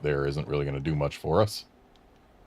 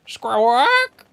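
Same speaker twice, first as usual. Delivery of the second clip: loud high bird squawk; parrot squawk; 6 dB louder than usual. Acted. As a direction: screech like a parrot.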